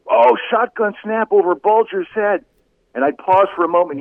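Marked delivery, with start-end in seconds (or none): 0.1-2.4 mock play-by-play call. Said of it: "I'd say".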